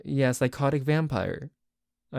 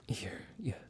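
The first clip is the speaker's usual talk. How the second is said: whisper to himself; 13 dB quieter than usual. Acted. Muttering to himself at work.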